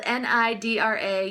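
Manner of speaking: N I D R A letter by letter; spelled out. Shown as a demonstration. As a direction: spell out N I D R A, each letter separate. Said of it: "So it's spelled".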